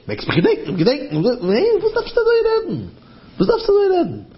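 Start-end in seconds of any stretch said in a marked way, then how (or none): 1.8-2.9 a high put-on voice, scolding; mock-scold him from way up high; high rebuking voice. Storyteller voicing a rebuke, high and scolding. 3.4-4.3 high rebuking voice. Retold or imitated. Repeated rebuke in story.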